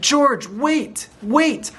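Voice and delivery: high-pitched call to wait; urgent calling out. A narrator's reading. The nurse running after George, urgent high call. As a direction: call after someone leaving.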